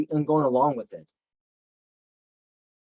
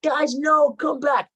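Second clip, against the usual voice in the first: high, loud pleading cry as he's dragged off; desperate yell; 11 semitones higher, 4 dB louder, slower than usual. Retold or imitated. Joseph screaming to his brothers as he is sold, desperate high yell. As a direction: scream it after brothers leaving you. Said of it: "he's yelling".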